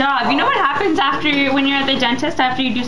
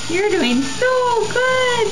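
soothing praise; 7 semitones higher, much slower than usual. Real, not acted. Praising child at dentist.

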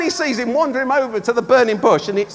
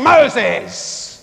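harsh mock bark of the name; angry God. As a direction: call the name in put-on annoyance. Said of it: "He didn't say".

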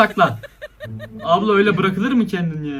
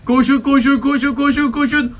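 mock cheering on; 5 semitones higher than usual. Urging runners on screen.

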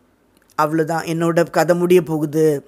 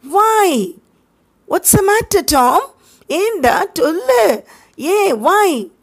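put-on high, worried boy's voice; anxious boy; 11 semitones higher, 4 dB louder, much slower than usual. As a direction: press him for what's wrong. Sid anxiously asks why. (பதட்டமான உயர்ந்த குரலில் கேட்பது)

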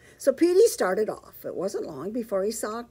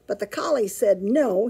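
ordinary delivery, nothing marked

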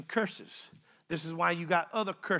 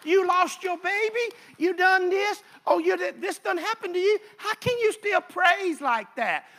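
higher mocking voice of a doubter; 11 semitones higher, 6 dB louder than usual. Preacher voicing scoffers who ask how he praises. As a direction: throw the doubters' taunts back high.

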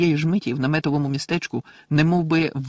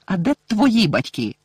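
angry, higher and slow; furious question; 6 semitones higher, 5 dB louder, slower than usual. A narrator's reading. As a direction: demand it angrily, word by word. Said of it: "спитав розлючено Гаррі".

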